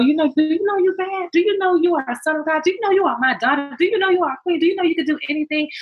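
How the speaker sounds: retold pep talk. Mother firing affirming questions at her daughter, high retold pep talk. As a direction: reenact rousing a child with rapid-fire affirmations. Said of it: "I bring it to her level".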